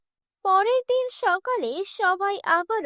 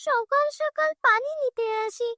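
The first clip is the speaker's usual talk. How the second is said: village woman grumbling in a slightly raised pitch; complaining village woman; 7 semitones higher than usual. Acted. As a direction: grumble like a woman stuck in the water queue. Village woman waiting in the water queue.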